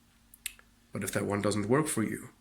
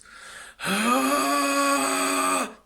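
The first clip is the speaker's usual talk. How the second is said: harsh breathy rasp; rasped compressed exhale; 6 dB louder than usual. Shown as a demonstration. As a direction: push air through compressed throat. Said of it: "That's how that sounds".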